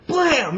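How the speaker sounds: a sudden loud "blam" explosion noise, pitched high; mock explosion. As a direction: burst out with a loud, high blast sound. Mimicking the bomb going off.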